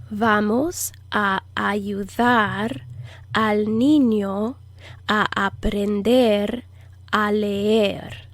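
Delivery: slow Spanish, a pause after each phrase; deliberate, drawn-out teaching delivery; slow phrase-by-phrase model. Shown as a demonstration. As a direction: break it into chunks for learners to repeat. Teacher breaks sentence into phrases.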